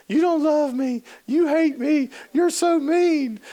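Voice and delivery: very high mock tantrum wail; wailing child. Daughter on the floor wailing at her mom, high whiny tantrum. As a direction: whine it like a kid throwing a fit.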